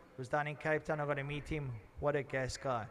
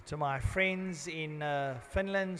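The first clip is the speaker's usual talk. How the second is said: ordinary talk, nothing marked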